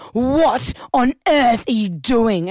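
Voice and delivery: slow, disapproving neighbour voice; grumpy neighbour. Retold or imitated. Neighbour confronting mum over noisy child, slow disapproving. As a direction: drag out the neighbour's scolding. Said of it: "he goes".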